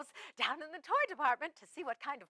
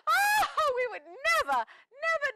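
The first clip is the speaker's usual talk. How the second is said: mock outrage; 11 semitones higher, 4 dB louder, slower than usual. Outraged denial of the joke.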